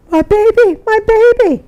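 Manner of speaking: very high, acted frantic wail; mother screaming. Mother whose baby fell down the well, screaming. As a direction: imitate a panicked mother's high cry. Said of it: "the mother was just screaming".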